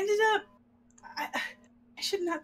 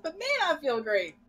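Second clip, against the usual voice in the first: usual speaking voice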